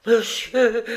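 high, loud gasp of a word; devastated cry. A narrator's reading. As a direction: cook crying out in shock. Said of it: "s'écria la cuisinière anéantie".

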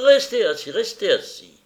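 sudden loud, high command easing on the repeat; shouted order. A narrator's reading. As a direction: cry out to make him stay put. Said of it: "Velchnaninov lui cria". (ordre crié, fort et aigu)

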